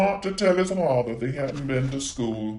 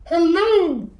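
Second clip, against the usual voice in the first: high, loud, otherworldly hello; a talking piece of wood calling out high; magic wood voice; 12 semitones higher, 6 dB louder than usual. Acted. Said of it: "he heard a voice".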